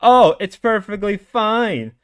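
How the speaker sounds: mild mocking parody; mocking quote. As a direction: sneer as if parroting him. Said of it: "your groomer ass mindset".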